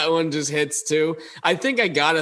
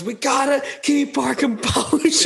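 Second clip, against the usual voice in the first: laughing through quote; 12 semitones higher than usual. Retold or imitated. Retelling the same locker-room line.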